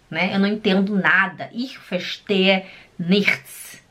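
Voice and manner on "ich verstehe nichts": very slow model phrase; bem devagar. Shown as a demonstration. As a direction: drag it out so the class can repeat. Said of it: "Fala comigo". (repita comigo, bem devagar)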